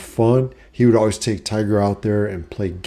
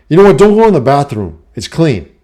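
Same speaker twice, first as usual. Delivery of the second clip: loud, higher command that fades out; bossy mom; 5 semitones higher, 7 dB louder, faster than usual. Retold or imitated. Mom forbidding son the clean bathroom, bossy. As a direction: lay down the rule firmly. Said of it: "And she's like".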